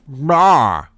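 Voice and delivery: very loud wordless-sounding roar; exasperated outburst. Real, not acted. Streamer appalled by friend's ratings, roaring exasperation. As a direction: bellow it, lost for words. Lost for words in outrage.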